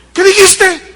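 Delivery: sharp high indignant shout; outraged wife shouting back; surprised shout. Acted. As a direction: snap back in disbelief. Wife reacts in marital joke.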